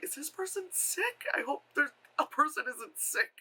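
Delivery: high, hushed mock concern; worried onlooker. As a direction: imitate concerned strangers, high and soft. Acted worried onlookers at the con.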